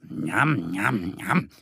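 lip-smacking yum yum from a little bear. A narrator's reading. Little bear Mo eyes the mushroom, lip-smacking. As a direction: relish an imagined mushroom treat. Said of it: "schmatzt Mo".

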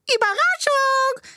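high, drawn-out party yell; imitated high, slow party shout; mock surprise yell. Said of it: "die schreien".

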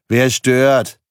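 loud, gruff intercom snap; grumpy bark. Acted. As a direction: bark it like someone woken from a nap.

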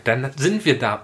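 ordinary delivery

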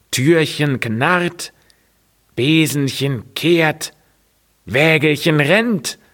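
lightly voiced tree lament. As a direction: recite the woes like a shaking tree. Tree lists the woes.

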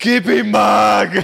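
cheering shout. Real, not acted.

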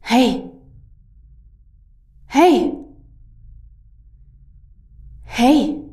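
loud repeated calls from afar; calling out. Acted. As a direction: call out to someone unseen. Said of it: "hörte sie eine Stimme rufen".

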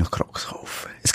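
hushed conspiratorial whisper. Real, not acted. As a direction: whisper it like a secret. Whispered aside.

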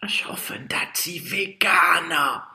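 high, breathless unvoiced whisper. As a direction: whisper it fearfully. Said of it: "flüstert Janine".

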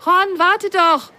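high, slow calling out; calling after. A narrator's reading. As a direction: call after him across the station. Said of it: "rief Lili ihm hinterher".

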